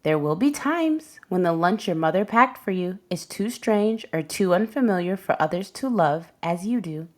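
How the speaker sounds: ordinary delivery, nothing marked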